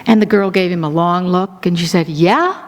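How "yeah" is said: mock-skeptical retort with a questioning rise on "Yeah"; sarcastic disbelief. Dorm girl answering David's introduction, sarcastic disbelief. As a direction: imitate someone not buying it.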